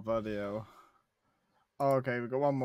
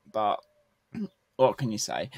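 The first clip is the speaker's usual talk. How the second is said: ordinary talk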